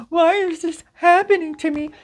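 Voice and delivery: very high, loud whiny wail; exaggerated self-pitying wail; mock self-pity. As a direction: whine like a sorry victim. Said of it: "Instead of going".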